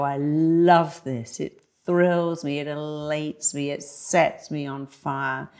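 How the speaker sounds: slow and relishing, every phrase drawn out; the speaker psyching themself up before a speech, slowly; slow, savoring self-talk. Shown as a demonstration. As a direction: savor each word like you mean it. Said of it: "No, you go".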